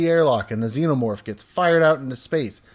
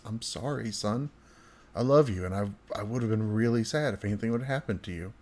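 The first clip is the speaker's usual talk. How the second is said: much softer, low apologetic murmur; quietly sorry, choking up a little; tender; 3 semitones lower, 8 dB quieter, faster than usual. Retold or imitated. Guilty dad apologizing to his son, soft and tender. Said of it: "He said tenderly, with tears in his eyes".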